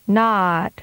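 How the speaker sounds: "Not." with the vowel held long; drawn-out "Not.". Shown as a demonstration. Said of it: "and then with a longer duration".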